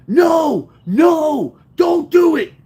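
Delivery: high, loud pleading shout; re-enacted alarmed yell, high-pitched. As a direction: shout after a running friend to stop him.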